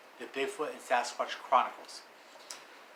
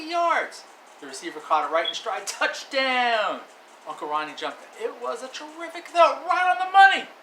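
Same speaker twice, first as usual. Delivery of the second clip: louder and higher sports call; excited play-by-play; 8 semitones higher, 7 dB louder than usual. Acted. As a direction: shout the touchdown like a commentator.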